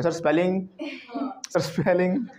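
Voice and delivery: mock a pupil pestering the teacher; schoolchild habit. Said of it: "हमारे बचपन से आदत हो रखी है".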